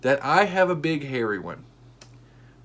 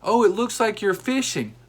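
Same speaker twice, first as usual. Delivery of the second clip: higher, lightly voiced lady; a hint of the lady calling out; lady's joke retold, lightly higher; lady's line; 8 semitones higher, 4 dB louder than usual. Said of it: "as soon as she said".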